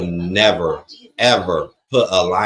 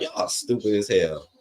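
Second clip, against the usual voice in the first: normal talking voice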